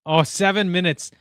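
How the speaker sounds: ordinary talk, nothing marked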